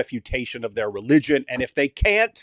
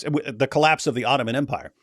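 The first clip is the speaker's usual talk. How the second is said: ordinary talk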